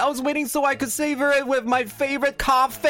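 high, indignant and whiny; outraged over cake; indignant roommate. Acted. Roomies skit, indignant.

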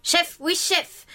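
slow, drawn-out soldier's reply; gung-ho soldier. Pretend. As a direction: answer like a recruit to a sergeant. Answers "au rapport" like soldier.

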